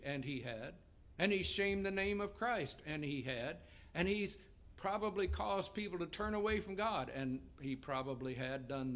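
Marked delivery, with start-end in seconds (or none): 1.2-2.8 higher, louder retold complaint; indignant. Pastor listing the harm done by another, mildly indignant. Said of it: "I'm mad at him". 3.9-7.1 raised, higher-pitched retold complaint; indignant. Pastor arguing why he is mad, mildly indignant. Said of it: "I'm mad at him".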